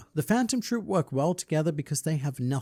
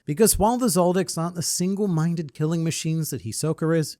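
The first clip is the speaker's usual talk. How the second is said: ordinary delivery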